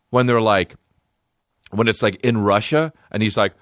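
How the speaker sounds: usual speaking voice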